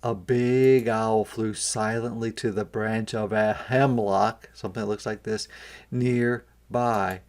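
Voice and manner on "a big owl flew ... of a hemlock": usual speaking voice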